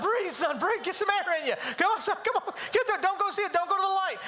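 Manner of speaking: high, urgent comic pleading; hammed-up frantic dad calling his son back; mock panic. Dad urges his breathless son to breathe, mock panic.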